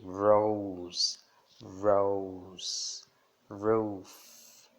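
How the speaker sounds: rose with a loud curled R; strong American R. Shown as a demonstration. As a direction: curl the R in rose. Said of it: "é bem evidente esse R". (R americano forte em rose)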